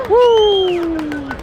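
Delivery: high, joyful woo cheer; cheering whoop. Real, not acted. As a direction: let out a celebratory woo. Cheering a winner.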